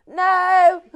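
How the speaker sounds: loud, high holler; shouted call. Retold or imitated. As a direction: shout it upstairs, long and loud. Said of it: "person down here shouts".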